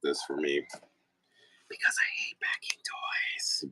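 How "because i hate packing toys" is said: whispered confession; whispered aside. Real, not acted. Aside while asking friend to pack.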